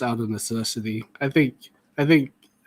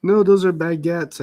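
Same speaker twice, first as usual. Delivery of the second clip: defensive; 5 semitones higher, 5 dB louder than usual. Pretend. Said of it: "I said".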